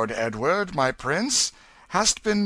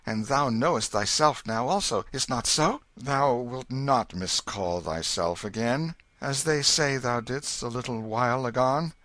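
higher, louder soothing fatherly voice; calm the frightened child; king reassuring his son; comforting king; 6 semitones higher, 5 dB louder than usual. Acted. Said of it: "patted it tenderly".

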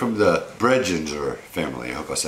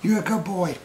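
put-on baby talk; 10 semitones higher than usual.

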